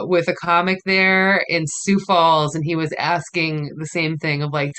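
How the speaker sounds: normal talking voice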